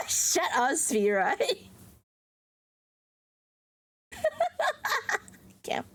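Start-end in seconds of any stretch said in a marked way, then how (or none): 4.1-5.2 1 high laugh. Real, not acted.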